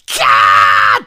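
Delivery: a startled councillor's mock scream; imitated shriek. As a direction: squeal like a startled woman. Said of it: "て言ったんですって".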